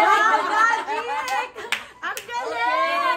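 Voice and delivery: mock amazement. Mother marveling at the lights for her child, slow and high. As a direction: ham up the awe for the kid.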